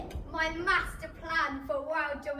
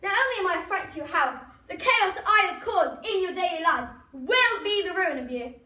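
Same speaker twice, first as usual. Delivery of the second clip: ranting villain; 5 dB louder than usual. Acted. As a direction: rage against the doctors. Villain thwarted, defiant.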